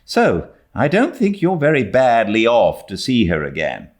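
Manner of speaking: a hint of a scolding guardian; mildly put-on older woman, chiding; lightly voiced stern Marilla. A narrator's reading.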